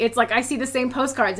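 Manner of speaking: usual speaking voice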